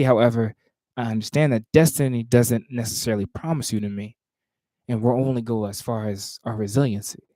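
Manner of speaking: measured, rhythmic poetry recitation; spoken word performance cadence; spoken word poem. Acted. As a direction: perform the poem with weight on each phrase.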